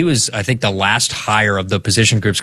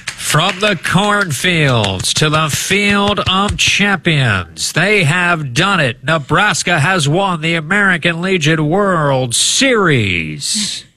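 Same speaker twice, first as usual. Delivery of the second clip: much higher, slow, grand championship call; old radio announcer; 7 semitones higher, slower than usual. Pretend. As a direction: swell up like a vintage radio finale. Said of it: "I did that call on tape delay".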